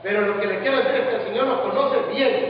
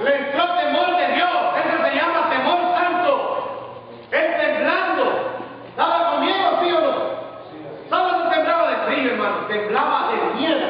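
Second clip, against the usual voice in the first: raised, high-pitched sermon voice; impassioned preaching; 6 semitones higher than usual. Real, not acted. Preacher explaining Saul's holy fear to the church, impassioned preaching. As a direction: drive each point home to the congregation.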